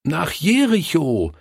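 put-on high, eager answer from the children; children calling out. A narrator's reading. Children helping the forgetful pastor, calling out eagerly. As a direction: pipe up with the missing word. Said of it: "riefen sie".

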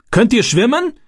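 high, urgent shouted question; bellowed question. A narrator's reading. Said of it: "brüllte der Mann". (gebrüllte Frage, hoch und dringlich)

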